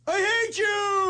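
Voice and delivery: high, drawn-out heckler impression. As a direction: lurch between pleading and yelling like a heckler. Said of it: "he was heckling me like this".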